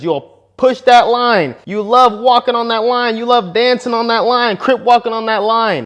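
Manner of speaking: high, loud, slow teasing sing-song. Acted. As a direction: stretch it out, mocking them playfully. Teasing women about crossing lines.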